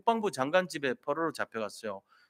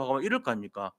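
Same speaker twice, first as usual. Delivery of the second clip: usual speaking voice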